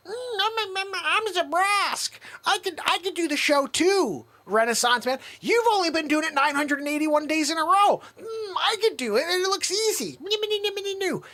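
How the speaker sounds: host mocking Zabrosk in a high silly whine. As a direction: play a clueless sidekick begging. Host mimics Zabrosk asking for show.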